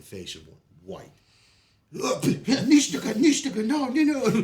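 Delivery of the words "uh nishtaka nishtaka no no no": mock panicked protest. Afghan guy reacting to the dip.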